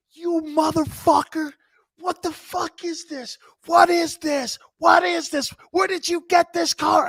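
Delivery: high, loud, slow enraged shouting; furious coach yelling. Retold or imitated. Coach screaming at his player over the car. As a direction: blow up at the player like a livid coach.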